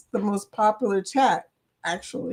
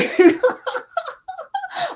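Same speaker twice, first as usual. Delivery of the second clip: big laugh. Real, not acted. Reacting to chat's joke.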